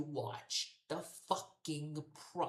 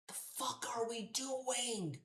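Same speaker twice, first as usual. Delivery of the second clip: incredulous whisper; 8 semitones higher, slower than usual. Real, not acted. Exasperated at booking choice.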